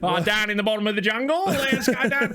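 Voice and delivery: high, hammed-up Australian accent; playful mock Australian, rising in pitch; bad Australian accent. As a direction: badly fake an Australian accent. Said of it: "Australian accents".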